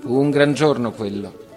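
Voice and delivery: ordinary delivery, nothing marked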